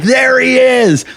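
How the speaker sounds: high, stretched-out cry of discovery; long high-pitched call. Retold or imitated. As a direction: point out the culprit to the cops, drawn out. Pointing out gunman to cops.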